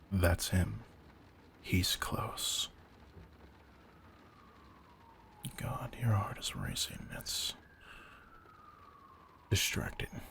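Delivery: soft, near-whispered murmur; hushed and close. Acted. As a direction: drop to a breathy near-whisper. Sensing the vampire, leaning in close.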